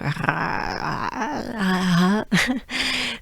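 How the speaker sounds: long, exaggerated throaty French R; guttural French R. Shown as a demonstration. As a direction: drag out a rasping R from the throat. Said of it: "some French accents are more, um, guttural".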